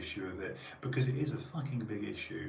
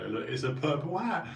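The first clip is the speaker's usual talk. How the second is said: normal talking voice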